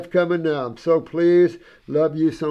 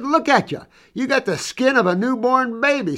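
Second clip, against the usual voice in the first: much higher, marvelling; higher voice full of wonder; amazed exclaim; 9 semitones higher, 3 dB louder than usual. Retold or imitated. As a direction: gush over how young she looks. Marvelling at ninety-six-year-old.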